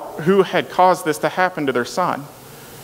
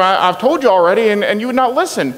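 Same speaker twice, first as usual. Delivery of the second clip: raised, exasperated retort; fed up with repeating himself; irritated; 7 semitones higher, 4 dB louder than usual. Acted. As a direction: pitch climbing higher and louder with exasperation. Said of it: "getting irritated by this now".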